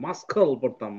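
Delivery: ordinary talk, nothing marked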